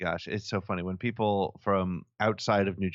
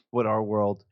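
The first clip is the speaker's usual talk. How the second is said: ordinary delivery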